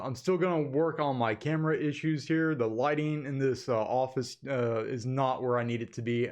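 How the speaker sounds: normal talking voice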